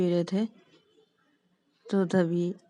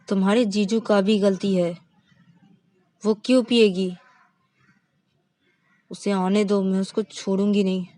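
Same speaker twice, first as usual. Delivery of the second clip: angry threat; 7 dB louder than usual. Acted.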